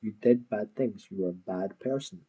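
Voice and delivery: ordinary talk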